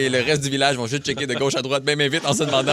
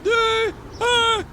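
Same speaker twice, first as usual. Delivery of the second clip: high excited countdown before the jump; bungee jumper. Pretend. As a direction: count down the jump, then yelp. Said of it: "Voici un extrait".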